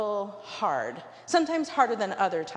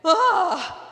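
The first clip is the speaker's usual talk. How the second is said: sudden loud high yelp of fright; flustered yelp; 11 semitones higher, 10 dB louder than usual. Retold or imitated. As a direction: blurt a panicked cry. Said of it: "sort of flustered, went".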